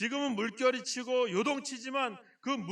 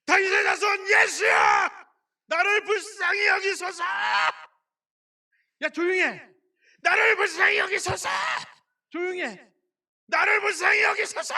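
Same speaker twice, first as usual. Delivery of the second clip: much higher and louder, desperate shouts; blind beggar crying out; 9 semitones higher, 10 dB louder than usual. Acted. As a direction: shout over the crowd to be heard. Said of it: "그 수많은 사람들이".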